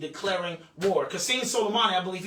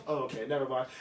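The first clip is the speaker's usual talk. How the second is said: normal talking voice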